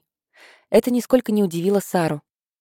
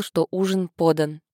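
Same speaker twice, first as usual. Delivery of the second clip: ordinary delivery, nothing marked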